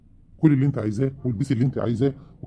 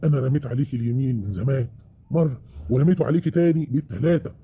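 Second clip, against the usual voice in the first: ordinary talk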